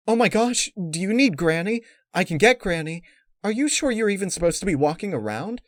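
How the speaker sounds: fretting over a friend in labour; panicked. A narrator's reading. Twilight panics at contraction.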